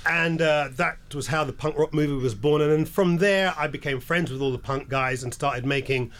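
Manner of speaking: normal talking voice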